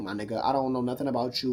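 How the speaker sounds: ordinary talk, nothing marked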